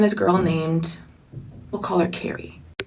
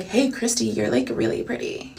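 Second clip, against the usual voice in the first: soft whispered compliment; Dakota's whisper; 8 dB quieter than usual. Retold or imitated. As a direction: whisper it sweetly like the roommate. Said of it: "that's how she talks".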